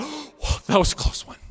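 high, gasped whoa at a near miss; shaken relief. Acted. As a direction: let out a startled, breath-caught reaction. Acted relief after a close call.

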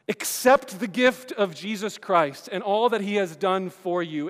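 slow, loud preacher's appeal; a pleading altar-call plea; preaching. Real, not acted. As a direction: press the invitation home, slowly.

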